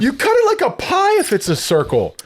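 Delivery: high-pitched exasperated rule-giving; mock indignant lecture. Real, not acted. Explaining to his friend how to cut a circle, high mock indignation. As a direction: scold him like it's obvious.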